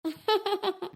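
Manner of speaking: soft, high little chuckle; covering laugh. Acted. Parent laughing off the son's remark, soft chuckle. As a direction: laugh it off softly.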